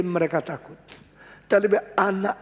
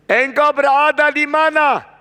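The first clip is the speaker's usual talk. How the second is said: forceful preaching; 9 semitones higher, 7 dB louder than usual. Acted. A preacher presses the congregation, loud and high. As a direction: drive the question home.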